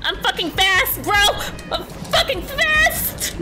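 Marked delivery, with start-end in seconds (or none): 1.7-3.4 high-pitched, drawn-out cry; slow, high triumphant wail; squealed boast. Real, not acted. Boast repeated while fleeing.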